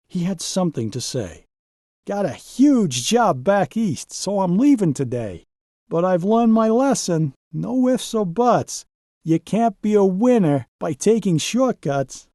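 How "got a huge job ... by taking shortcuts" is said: a higher, lightly voiced truck saying goodbye; humbled Donald. Donald telling the crew his lesson, higher and sincere. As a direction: share the lesson learned, sincere.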